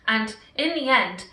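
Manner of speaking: usual speaking voice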